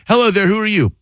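high-pitched, sing-out show welcome; announcer-style hello to a caller; radio host greeting. Acted. Host redoes his on-air greeting.

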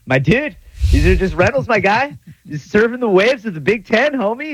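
high, lazy surfer dude voice; aloof surfer. Retold or imitated. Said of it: "say it like an aloof surfer".

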